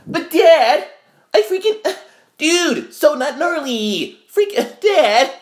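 put-on very high, slow valley-boy whine; surfer-dude Simba. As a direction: camp it up as a whiny surfer kid. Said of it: "And then he's like".

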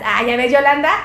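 ordinary talk, nothing marked

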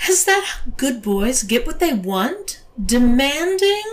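high, rising, threatening question; menacing query that climbs and swells; threat in the tone. A narrator's reading. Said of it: "said with a threat in her tone".

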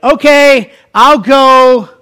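high, loud drawn-out agreement; imitating a flattered man agreeing; acted giving in. Balaam gives in, ego flattered.

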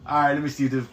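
ordinary talk

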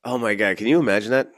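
normal talking voice

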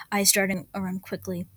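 plain reading voice

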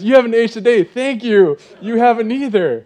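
high, loud and giddy with a laugh; flattered laughing reply. Retold or imitated. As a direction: thank him while laughing at yourself.

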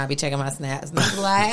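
normal talking voice

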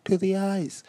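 high-pitched, polite British voice; British accent. Pretend. Podcaster praising a new word, mock-polite British accent. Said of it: "automatically I go into a British accent".

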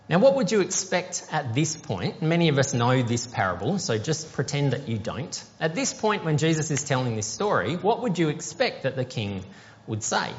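ordinary delivery

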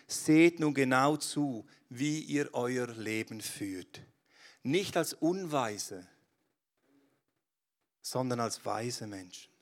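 slow, weighty reading of Paul; scripture reading. Acted. Preacher reads Ephesians to the church, slow and solemn. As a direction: read Paul's words slowly and gravely. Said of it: "Da schreibt Paulus".

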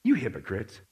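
low, quiet rebuke as Jesus. Acted. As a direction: rebuke them under restraint, voice dropped. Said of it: "And what's Jesus say?".

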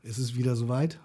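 normal talking voice

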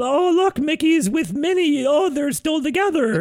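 high mocking gushing voice; mocking Disney fan. Podcast host mocks his cohost as a gushing Disney fan. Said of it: "he's going".

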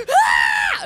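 very high, loud fan shriek; imitated crowd scream. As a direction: scream like the kids seeing a star. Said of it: "seeing these kids go".

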